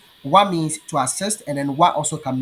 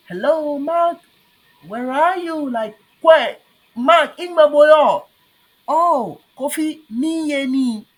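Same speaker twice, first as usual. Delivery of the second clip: calling out; 10 semitones higher, 6 dB louder, slower than usual. Acted. Acted dialogue example.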